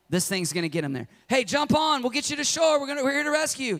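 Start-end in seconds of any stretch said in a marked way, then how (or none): 1.3-3.8 higher, brisk rescue call; cheerful rescuer. Retold or imitated. Rescuer calls to man on roof, brisk higher voice. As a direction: wave him aboard quickly.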